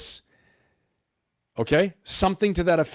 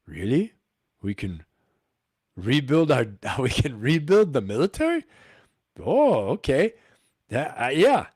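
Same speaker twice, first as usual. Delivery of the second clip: Doctor Evil moment. Retold or imitated. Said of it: "they had their Doctor Evil moment".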